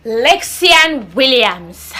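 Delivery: slow name call. Acted. Teacher calls name at report day.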